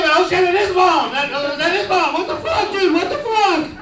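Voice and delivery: high-pitched, acted disbelief; horrified shock. Teen recognizing his mom on the tape, horrified. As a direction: let the shock push your voice up high.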